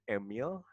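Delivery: "Emil" said as EH-mil; "Emil", opening on eh, not ee; wrong "email" pronunciation. Shown as a demonstration. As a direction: show the mistake "Emil", short second vowel. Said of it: "atau bukan Emil".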